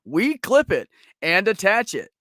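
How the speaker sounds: loud, booming store-ad announcer; hammed-up commercial announcer. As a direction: pitch it like a cheesy radio ad. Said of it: "I'm gonna do it in my announcer voice".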